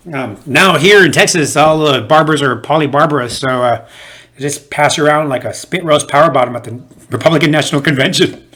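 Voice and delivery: ordinary delivery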